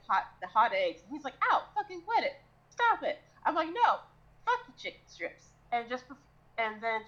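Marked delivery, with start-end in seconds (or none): 1.5-3.2 high-pitched mock yelp of annoyance; irritated protest. Housemate pelted with hot eggs, acted irritated yelp. 3.7-5.5 high-pitched mock defiance; acted bickering. Cook throwing food at housemate, acted defiant bickering.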